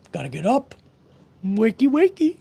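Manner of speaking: high playful wakey wakey; singsong wake-up voice. Pretend. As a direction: coax someone awake in a high singsong.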